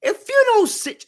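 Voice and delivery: high-pitched imitation of a scolding grandmother; scolding grandmama. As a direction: channel grandma telling a kid off. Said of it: "I heard grandmama".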